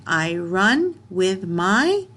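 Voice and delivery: slow reading. Shown as a demonstration. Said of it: "teacher reads sentence slowly".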